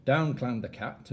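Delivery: usual speaking voice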